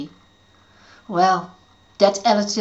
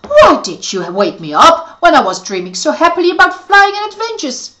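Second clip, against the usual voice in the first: muttering; 8 semitones higher, 10 dB louder than usual. Acted. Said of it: "he muttered".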